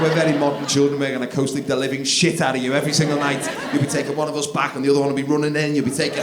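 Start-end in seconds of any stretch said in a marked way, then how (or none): none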